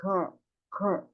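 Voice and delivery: "ker" as a short open gah-like syllable; English sound of girl. Shown as a demonstration. Teacher shows how girl sounds in English, short soft "ker". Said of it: "ang sounds ng girl sa English ay".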